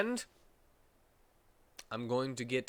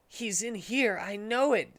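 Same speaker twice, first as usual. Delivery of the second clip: put-on higher, eager kid-sidekick voice; excited young sidekick sure of himself; Knuckles Junior voice; 8 semitones higher than usual. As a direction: point and tell them he's hiding right there. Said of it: "said Knuckles Junior".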